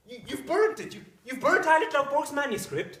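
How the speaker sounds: high, loud outburst of disbelief; horrified scream. Acted. Husband learns his wife burnt the manuscript, horrified shout. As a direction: cry out aghast at the news. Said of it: "Don't scream".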